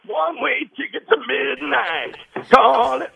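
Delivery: high, slow dramatic announcer voice; drawn-out trailer-style read, punched at the end; dramatic movie line. Retold or imitated. Quoting a movie line to the hosts, dramatic and slow.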